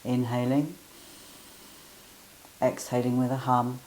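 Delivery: calm guiding voice with hums; guided breathing. Acted. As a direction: lead the humming breath gently. Leads humming bee breath.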